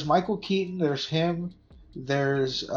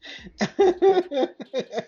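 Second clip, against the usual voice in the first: big laugh; 13 semitones higher than usual. Real, not acted. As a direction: burst out laughing. Laughing at the impression.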